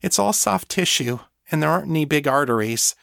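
high, earnest thrall voice; helpful thrall. Acted. Thrall instructing her mistress on biting, helpful.

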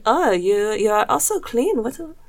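put-on slipping into a playful German accent by mistake; mock German accent. Podcast host mocking French women, mock German accent. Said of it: "oh, that's a German accent".

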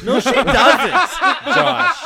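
laughing protest. Real, not acted. Defending his match to friends, laughing protest. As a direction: laugh it out, pushing back. Laughing denial about Amber.

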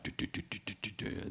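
soft, rhythmic wheel ticking; imitated spinning wheel. Crash survivor mimics the motorcycle's still-spinning back wheel, soft repeated ticking. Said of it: "bakhjulet snurrade så här".

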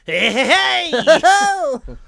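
loud, high clown holler; Krusty the Clown. Shown as a demonstration. Showing co-host how to do Krusty, loud clown shout. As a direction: belt it out high like a cartoon clown. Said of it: "you wanna show him Krusty".

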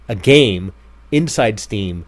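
ordinary talk

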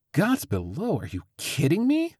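high, shocked exclamation; exclaiming. Acted. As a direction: cry out in disbelief. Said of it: "Lydia exclaimed".

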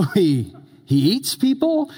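high alarmed question; mock alarmed question. Villager imagining a man-eating rat, high mock alarm. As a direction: sound horrified at the thought.

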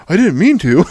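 mock-pleading teenage boy; imitating the scared teen. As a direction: squeak it out like a caught kid. Teen caught, voiced by dad.

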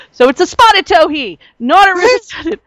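high, animated correction; excited. Real, not acted. Birder shares the new species name, excited. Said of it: "you say it very excitedly".

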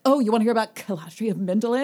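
fast, loud eager gushing; eager nerd. Retold or imitated. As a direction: pounce on them with eagerness. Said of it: "I'll be like".

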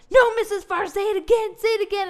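put-on high, loud eager children; excited kids voice. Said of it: "children were like".

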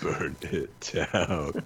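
very slow, grave mock decree; dramatic villain voice. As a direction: intone it like a doom-laden tyrant. Joins mock dramatic bit.

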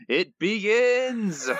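put-on slow, high, laughing drunk Barney cry; Barney's gleeful cry. Reading a listener's Barney quote, laughing Barney voice. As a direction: stretch it out with a manic laugh. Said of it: "takes a sip of non-alcoholic champagne".

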